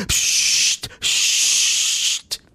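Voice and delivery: two hissed unvoiced shushes; drawn-out mock psst psst; imitated shush. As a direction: shush the room like the host. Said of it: "Und dann".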